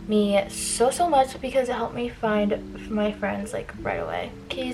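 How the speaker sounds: normal talking voice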